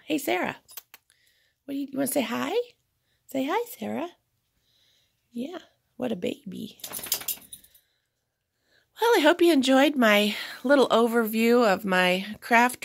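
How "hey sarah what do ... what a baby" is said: pet talk. Real, not acted. Greeting the pet dog.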